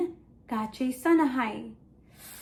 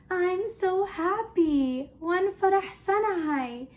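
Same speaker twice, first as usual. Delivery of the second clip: happy; 5 semitones higher than usual. Shown as a demonstration. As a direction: act out being so happy for children. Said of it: "I'm happy".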